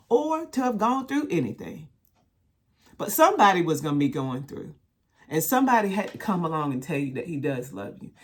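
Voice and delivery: rising to loud fervent testimony; testifying to those still being abused; impassioned preaching. Real, not acted. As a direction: pour out your heart at full force.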